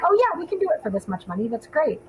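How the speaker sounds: high, eager and breezy; overconfident excitement. Retold or imitated. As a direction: gush like a naive planner. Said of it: "we were like".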